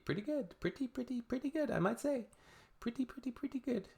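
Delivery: high, soft, smug little brag; a high, soft self-satisfied singsong; smug bragging voice. Pretend. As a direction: coo it like showing off. Mock bragging about credit score.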